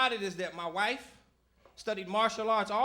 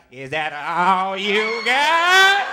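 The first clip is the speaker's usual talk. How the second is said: slow, scornful challenge; 2 semitones lower, 12 dB louder, much slower than usual. Retold or imitated. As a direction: drag each word out slowly. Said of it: "she be like".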